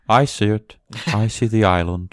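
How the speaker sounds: lightly put-on actor's character voice; Kylo Ren impression. Co-host quoting the character to test the theory, light impression. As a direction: imitate a film villain's odd delivery. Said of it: "He's like".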